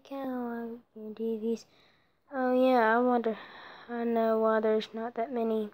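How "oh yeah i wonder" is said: usual speaking voice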